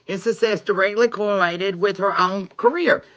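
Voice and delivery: ordinary talk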